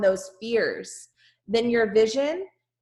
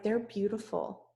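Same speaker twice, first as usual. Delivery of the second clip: dreamy awe; 6 dB quieter than usual. Retold or imitated. Awed at new pool pavers.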